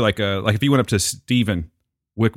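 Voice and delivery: normal talking voice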